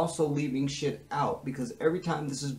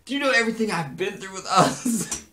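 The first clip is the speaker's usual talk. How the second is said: aggrieved plea; 6 semitones higher, 4 dB louder than usual. Acted. [name]'s acted aggrieved plea.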